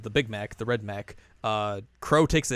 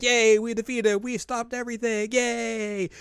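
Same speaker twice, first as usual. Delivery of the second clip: mock cheering; 10 semitones higher than usual. Party cheering after the win.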